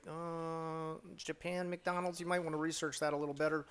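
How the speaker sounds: high, fast, sarcastic correction; mock condescending. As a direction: talk down to them like a smug critic. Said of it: "And it's like".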